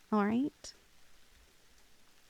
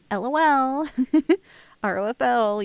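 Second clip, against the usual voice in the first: laughing loudly; 2 semitones higher, 9 dB louder than usual. Acted. Laughs off her creepy confession.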